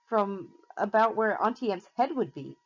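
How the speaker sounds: ordinary delivery, nothing marked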